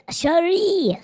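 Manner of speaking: a high breathy whisper of the name. Acted.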